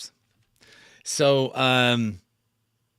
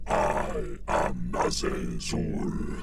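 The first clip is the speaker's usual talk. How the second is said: deep effect mixed with natural voice; half demon, half human, slow; blended monster voice; 9 semitones lower, 4 dB quieter, much slower than usual. Shown as a demonstration. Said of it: "I'm blending my voice into it".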